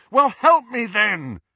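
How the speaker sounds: high, loud, drawn-out demand; demanding shout. A narrator's reading. Harry cuts in on the ghost, demanding shout. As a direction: cut in and demand help.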